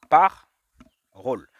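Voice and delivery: "parole" broken into loud "par", pause, "role"; word split apart. Shown as a demonstration. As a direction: drag out "parole" in two parts, first one loud. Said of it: "Le premier, c'est de faire ra". (« par… role » en deux morceaux, très lentement)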